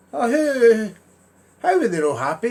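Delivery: lightly voiced astonished neighbour; mock surprise. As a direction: acts shocked for Joe. Voicing himself asking Joe.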